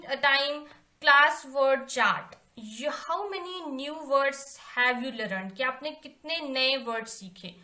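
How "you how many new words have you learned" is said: slow dictation. Shown as a demonstration. Reading task aloud to class.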